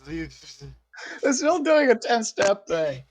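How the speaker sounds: whiny mock sob, slow; mock crying. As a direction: wail it like a fake cry.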